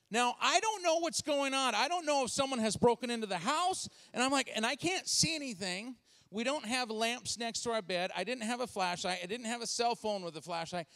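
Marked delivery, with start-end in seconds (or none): none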